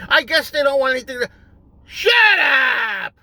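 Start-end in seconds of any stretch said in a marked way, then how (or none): 1.9-3.1 snapped shout. Real, not acted. Losing patience mid-rant, a sharp shout. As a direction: cut yourself off with a yell.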